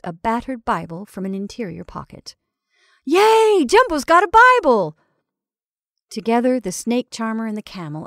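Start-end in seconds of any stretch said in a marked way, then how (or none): 3.1-5.0 very high, loud cheer. Acted. Crowd cheering at Jumbo's Bible, cheering. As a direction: whoop with delight.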